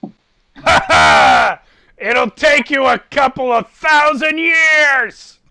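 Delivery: loud, high, gleeful shout; booming mocking laugh. Abraxiel shouting back at Ramakan's quip.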